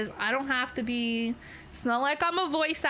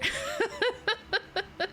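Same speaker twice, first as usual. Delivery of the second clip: short high-pitched giggle; bright little laugh; 10 semitones higher than usual. Real, not acted. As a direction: giggle at your own joke. Laughs about having riz.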